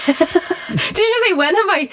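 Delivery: high, disbelieving echo of the question; can't believe what you just heard; incredulous. Real, not acted. Questioning the host's cheeky remark, high and incredulous.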